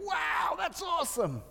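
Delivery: high, excited exclamation of awe; awed wow. Pretend. Preacher voicing a prophet's expected awe, excited. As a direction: gasp out wow like it's a marvel. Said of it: "instead of saying".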